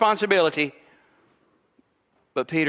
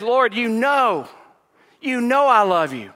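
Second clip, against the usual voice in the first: firm, insistent reply, a touch louder and higher; said very strongly; 7 semitones higher, 5 dB louder than usual. Retold or imitated. Preacher voicing Peter answering Jesus, strongly insistent. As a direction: press every word of the reply home. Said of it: "he says it very strongly".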